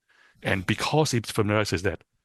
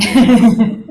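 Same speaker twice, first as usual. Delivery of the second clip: laughing; 12 semitones higher, 15 dB louder than usual. Real, not acted. Laughing correction of own joke.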